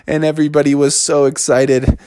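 ordinary delivery, nothing marked

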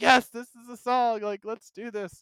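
high, drawn-out excited exclamation; acted-out rallying excitement, starting loud; re-enacted fan hype. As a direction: relive the thrill, stretching it out high. Re-enacts reaction to the theme song.

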